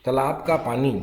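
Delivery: ordinary talk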